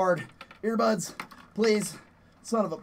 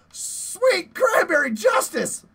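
drawn out; 9 semitones higher, much slower than usual. Real, not acted. Relief after the fight.

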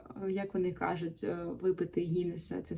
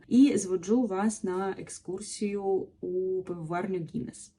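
ordinary delivery

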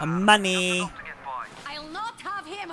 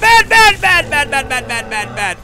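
high rapid-fire repeated yells, falling off at the end; panicked shouting; 13 semitones higher, 7 dB louder than usual. Real, not acted. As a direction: stammer it out loud and high in panic. Panicking in the game.

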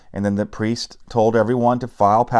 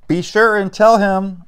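much higher, louder, slow sarcastic retort; 11 semitones higher, 5 dB louder than usual. A narrator's reading. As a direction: toss it back with defiance. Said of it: "retorted Maria".

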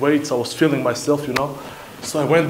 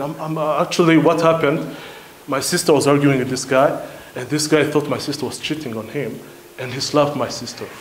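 ordinary talk, nothing marked

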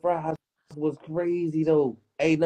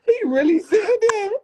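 much higher, louder, shrieking with laughter; howling with laughter at the story; laughing scream; 5 dB louder than usual. Real, not acted. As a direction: crack up and squeal the words.

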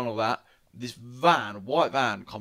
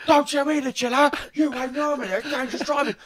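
high, louder mock rage of a van man; angry retort; 5 dB louder than usual. Retelling the van driver raging back at being told to chill, high and loud. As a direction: snap back at him furiously.

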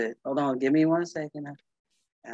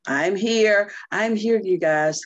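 bright, loud, higher, eager; excited and a little laughing; excited call; 6 semitones higher, 5 dB louder than usual. Real, not acted. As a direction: announce herself with a burst. Calling out eagerly to group.

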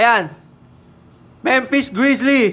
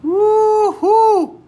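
excited whoop; 10 semitones higher than usual. Real, not acted. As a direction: cheer the jersey swap. Celebrating the pull.

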